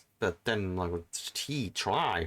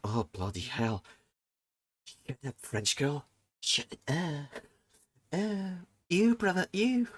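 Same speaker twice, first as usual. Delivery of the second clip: self-mocking disgust; 3 semitones higher, slower than usual. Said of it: "I'm like".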